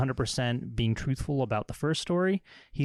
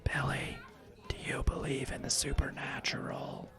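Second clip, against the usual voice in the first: whispered, secretive question; conspiratorial whisper; 3 semitones higher, 8 dB quieter than usual. Acted. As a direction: lean in and whisper it. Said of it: "his voice dropping to a conspiratorial whisper".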